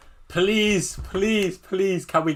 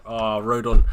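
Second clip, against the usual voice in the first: usual speaking voice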